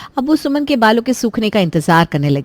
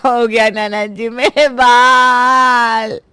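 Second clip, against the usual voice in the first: wailing; much slower than usual. Acted. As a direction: wail over her ruined hair. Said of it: "वो चिल्लाते हुए बाहर आई". (सफ़ेद बालों पर रोती हुई, धीमी विलाप भरी आवाज़)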